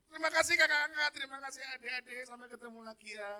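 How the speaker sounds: high, sing-out thanks, starting loud and easing off; calling farewell. Retold or imitated. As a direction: wave a group goodbye with bright thanks. Acting his farewell to the children. (berpamitan dengan seruan tinggi)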